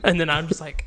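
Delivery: usual speaking voice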